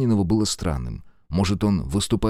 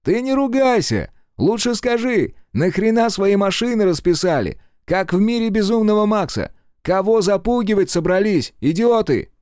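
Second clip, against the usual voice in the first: high, loud, mocking questions; shouting across; 13 semitones higher, 4 dB louder than usual. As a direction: shout at him, taunting. (громкий насмешливый крик издалека)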